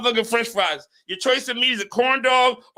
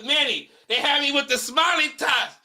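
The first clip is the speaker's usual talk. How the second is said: ordinary talk, nothing marked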